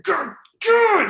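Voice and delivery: high, drawn-out exasperated cry; road rage. Retold or imitated. Driver cursing someone who won't move, exasperated. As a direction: wail it in frustration at another driver.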